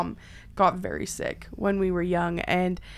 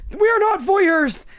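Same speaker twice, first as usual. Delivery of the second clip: higher, louder, slow outraged dad voice; mock angry dad; 11 semitones higher, 8 dB louder, slower than usual. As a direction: ham up the shocked father. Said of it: "coming home being".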